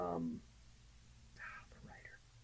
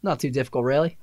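higher put-on Australian accent; 5 semitones higher than usual. Shown as a demonstration. Voice actor staying in requested accent, Australian accent. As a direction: toss it off as an easygoing Aussie. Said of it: "give me your best Australian".

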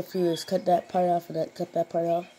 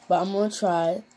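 ordinary talk, nothing marked

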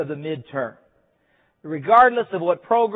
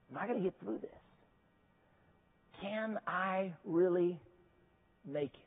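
doubting inner voice; 13 dB quieter than usual. Retold or imitated. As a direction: let the doubt sink to a near hush.

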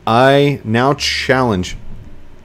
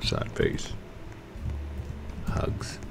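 much softer, tender murmur; soft hugs; 10 semitones lower, 16 dB quieter, much slower than usual. Real, not acted. Comforting the villager he yelled at, very soft. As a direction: hush down gently for the hugs.

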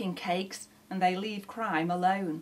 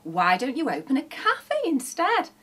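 louder, higher, eager pitch; excited suggestion; 5 semitones higher, 4 dB louder than usual. A narrator's reading. Sausage dog suggests a new job, excited. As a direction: offer the idea with enthusiasm. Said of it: "cried the sausage dog".

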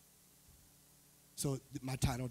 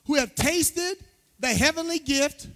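slow, raised pulpit reading with pauses; scripture reading; 11 semitones higher, 10 dB louder, slower than usual. Acted. Pastor reads Hebrews to the church, proclaiming it. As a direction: proclaim the verse phrase by phrase.

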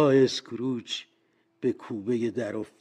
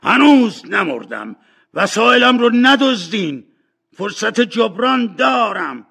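high, full-voiced excited shout; 11 semitones higher, 13 dB louder than usual. Scrooge shouting that he is not dead yet. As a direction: cry out that you still have a chance. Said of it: "فریاد زد".

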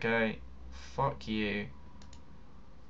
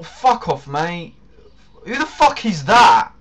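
swearing tirade; 5 semitones higher, 9 dB louder than usual. Real, not acted. Swearing at a player.

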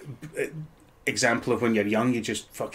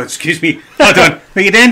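mock-indignant call, pitched up; mock interruption; 7 semitones higher, 12 dB louder than usual. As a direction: pretend outraged interjection, higher and much louder. Imagines interrupting another actor's bad accent.

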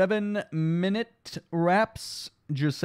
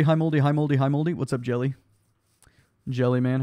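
normal talking voice

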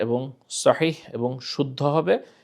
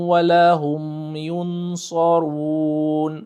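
slow, melodic tajweed recitation; Quran recitation; 4 semitones higher, much slower than usual. Shown as a demonstration. Teacher reciting a verse for students, slow melodic recitation. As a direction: chant the verse slowly and carefully.

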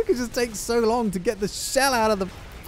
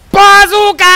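yelling; 13 semitones higher, 14 dB louder than usual. Real, not acted. Player announcing the shot, yelling. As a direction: yell it loud, stretching the vowels. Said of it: "the reason I'm yelling it".

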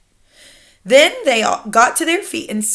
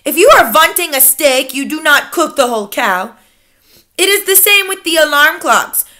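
imperious Grand High Witch; 4 semitones higher, 6 dB louder than usual. Acted. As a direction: lecture your followers with icy authority.

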